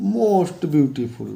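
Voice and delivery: most beautiful drawn out very slowly; teacher reading most beautiful slowly for students; slow model reading. Shown as a demonstration. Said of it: "और यहाँ पे".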